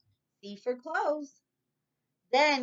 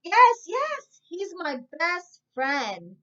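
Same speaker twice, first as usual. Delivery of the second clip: much higher, loud excited yes yes; joyful shout; 9 semitones higher, 8 dB louder, slower than usual. Acted. Lisa to the manager, joyful shout. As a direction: shout with joy on seeing him. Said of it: "shouted Lisa".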